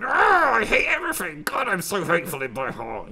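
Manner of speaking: shrieked high and loud, then settling; over-the-top mock misery in a squeaky voice; hateful emoji. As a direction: squeal like a cartoon hate emoji. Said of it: "a hate emoji going".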